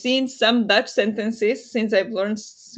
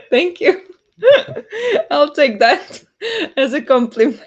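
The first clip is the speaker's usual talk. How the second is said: laughing; 7 semitones higher, 7 dB louder than usual. Real, not acted. Praised accent, laughing it off.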